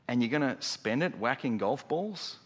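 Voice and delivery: normal talking voice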